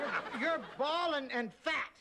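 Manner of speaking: high, slow, stammered insult; exasperated outburst. Acted. Co-host snaps after being taunted, high exasperated outburst. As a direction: finally blurt it back at the bully.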